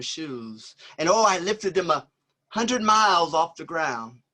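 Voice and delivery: rhythmic spoken-word performance, swelling and falling; poetry recital. Acted. Poet performing his verse to a live audience, rhythmic spoken word. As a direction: perform the poem like a jazz solo, riding the beat.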